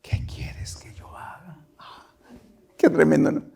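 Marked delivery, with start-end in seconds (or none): 0.0-1.6 hushed, breathless plea of a terrified man; trembling fearful whisper. A narrator's reading. Preacher voicing Saulo asking the Lord, fearful whisper. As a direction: whisper it as if shaking before God. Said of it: "temblando, temblando, temeroso".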